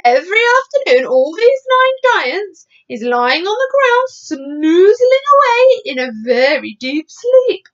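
high, loud, swooping storybook voice; animated BFG, voice rising high; eager giant explaining. Acted. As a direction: explain with relish, stretching words.

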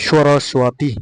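সরস্বতী with "swaa" in the middle; W sounded. Shown as a demonstration. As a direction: demonstrate the w glide in সরস্বতী. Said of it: "ওটা ওয়া উচ্চারণ করতে হবে". (সরস্বতী-তে "ওয়া" স্পষ্ট)

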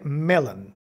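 "Melon" stressed on the first syllable, falling; model intonation. Shown as a demonstration. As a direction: say MEL-on, dropping away after the first syllable. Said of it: "La entonación es importante". ("Melon" con acento inicial y entonación descendente)

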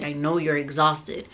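normal talking voice

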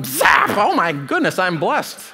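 playful mock amazement; mock surprise. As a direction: ham up a sudden miracle. Parodying instant magic blessing.

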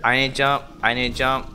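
loud and rushed, insisting; loud defensive denial. Real, not acted. Player insists to friends he wasn't scared, loud denial. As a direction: shout that you didn't flinch. Denies being startled, shouting.

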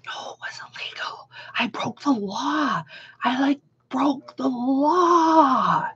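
whispery, tearful mock confession; squeak, then wail it softly and draw out the end; mock panicked whine. Traveler confessing an illegal gummy, mock-distraught. Retells panic at breaking the law.

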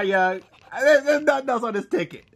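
normal talking voice

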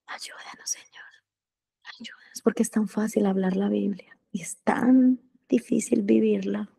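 breathy hushed plea to the Lord; whispered prayer. Real, not acted. Preacher whispers a prayer for help to God. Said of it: "whispered prayer to God".

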